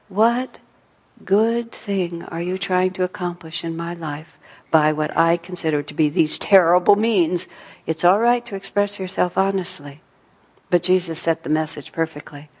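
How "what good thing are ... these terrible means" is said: ordinary talk, nothing marked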